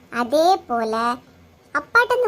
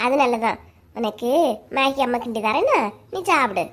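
ordinary delivery

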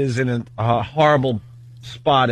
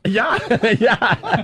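laughing; 8 semitones higher than usual. Real, not acted. Laughing while answering.

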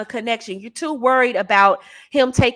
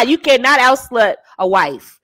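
ordinary talk

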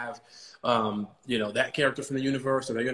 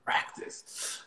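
whispered practice; 6 dB quieter than usual. Retold or imitated. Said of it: "You're talking about practice".

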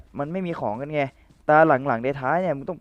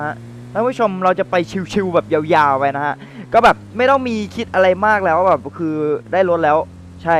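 loud, pumped-up cheer to the audience; excited to viewers; 4 semitones higher, 7 dB louder than usual. Real, not acted. Streamer hyping his viewers after finding a car, loud and excited. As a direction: hype the viewers up now that you have a car. Relieved to have found a car.

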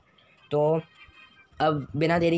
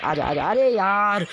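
high, loud, slowed wail of frustration; frustrated shout; 5 semitones higher, 4 dB louder, slower than usual. Real, not acted. Gamer watching the enemy win, frustrated loud wail. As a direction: drag it out in real annoyance. Fighting, losing.

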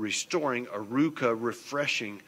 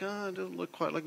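mock woman; 7 semitones higher, 5 dB quieter than usual. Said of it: "a woman's a little more of a realist".

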